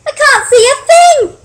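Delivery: very high, loud little-bird yell; piercing character shout; panicked yell. A narrator's reading. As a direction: yell it out as a frightened storybook bird. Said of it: "yelled Pip".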